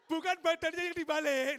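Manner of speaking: loud, high-pitched protest through laughter; laughing protest. Real, not acted. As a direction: crack up while correcting them loudly. Laughing at volunteers' mistake.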